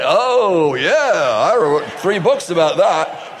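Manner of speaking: drawn-out "oh, yeah", then confident; comically sure of one's failings; knowing self-mockery. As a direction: answer like a ready expert. Eager answer about own failings.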